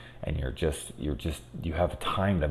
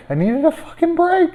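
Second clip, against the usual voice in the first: much higher, whining plea; high pleading whine; 6 dB louder than usual. Real, not acted. Mother begging for a break, high whine. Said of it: "repeated pleading in a high voice".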